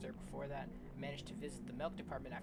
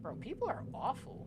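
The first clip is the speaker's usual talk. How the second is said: exasperated rant; 10 semitones higher, 6 dB louder than usual. Real, not acted. Exasperated aside to listener.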